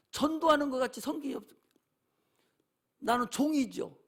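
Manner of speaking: ordinary talk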